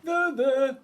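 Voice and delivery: high, loud, cooing bear; comforting bear. A narrator's reading. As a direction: soothe in a big, hearty bear voice.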